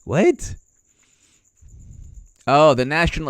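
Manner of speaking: usual speaking voice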